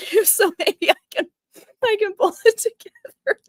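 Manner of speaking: real tears, trying to pull it together; crying. Real, not acted. Host moved by happy Broadway news, crying. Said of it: "No, I'm gonna keep crying again".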